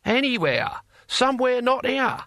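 high, emphatic dismissal; fed-up character snapping back; exasperated. Acted. Cohen exasperated with clinging followers.

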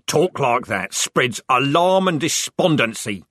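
slow, loud rebuke; shouting. A narrator's reading. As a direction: shout the warning, slowly. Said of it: "shouted Corporal Strappy".

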